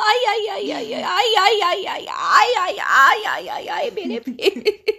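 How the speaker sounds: high, loud ow ow cries; loud pained yelps. Real, not acted. Mother yelping as son squeezes her feet, high and loud. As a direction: yelp in pain, half laughing.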